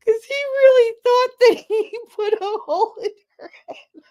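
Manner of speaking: high, squealing through real laughter; laughing hard. Real, not acted. Teller cracks up at his gullibility, laughing hard. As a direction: barely get it out for laughing.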